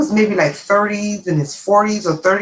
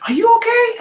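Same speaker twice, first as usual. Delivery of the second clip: concerned lady; 5 dB louder than usual. Retold or imitated. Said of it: "the lady's like".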